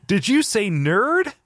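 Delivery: high, drawn-out indignant question; Raiden mock scream. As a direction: imitate an insecure hero, slow and high. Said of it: "Raiden's person to his screaming".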